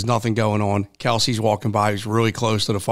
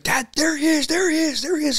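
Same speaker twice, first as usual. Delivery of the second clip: high, thrilled, repeated shout; a boy spotting his hero; excited kid screaming. Retold or imitated. Son telling his dad he spotted the player, excited yelling. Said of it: "he's screaming".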